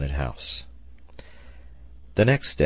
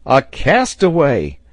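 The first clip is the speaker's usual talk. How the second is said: loud, high, drawn-out exclamation; excited sailor's outcry; exclaiming; 8 semitones higher, 8 dB louder, slower than usual. A narrator's reading. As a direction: cry it out in astonishment, high and slow. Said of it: "exclaimed Pencroft".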